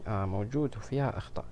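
usual speaking voice

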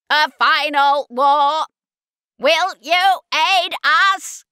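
very loud, high, slow Dalek. Acted. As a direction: blare it out like a Dalek.

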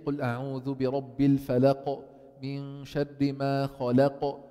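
slow chant with bounced qaf in الفلق and خلق; recite, echoing the qaf ending الفلق and خلق; qalqalah recitation. Shown as a demonstration. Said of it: "This vibration is the قلقلة".

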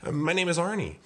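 high, sugary politeness; high, ingratiating introduction; sweetest voice. Acted. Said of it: "said in his sweetest voice".

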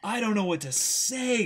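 high and loud, lost for words; overwhelmed, can't find the words; stunned excitement. Real, not acted. Telling viewers how the ending hit, loud stunned excitement. Stunned after finishing volume.

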